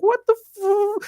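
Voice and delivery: high-pitched mock alarm, cut off; retold panicky yelp, breaking off mid-curse; startled shock. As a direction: squeal it in hammed-up shock. Retelling shock at giant spider.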